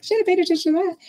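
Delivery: light mock dismay at a missed clue; rueful realization. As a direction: catch yourself out, half laughing at it. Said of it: "I of all people feel like".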